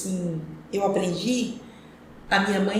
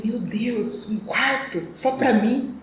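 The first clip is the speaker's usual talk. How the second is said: mild wonder, slowing and drawn out; retold amazement; 2 semitones higher, much slower than usual. Recalling her first room of her own, mildly amazed. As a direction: slowly voice a remembered moment of disbelief.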